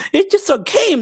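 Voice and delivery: mock-exasperated high cry; exasperated outburst. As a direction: squeak it out in disbelief at their fuss. Retold outburst at emotional players.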